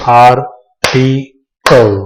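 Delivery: आर्टिकल slowly as ar, ti, kal with long gaps; clap-method syllables. Shown as a demonstration. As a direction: beat out आर्टिकल in three parts. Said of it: "clap method के जरिए पढ़ेंगे".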